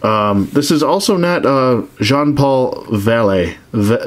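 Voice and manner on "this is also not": normal talking voice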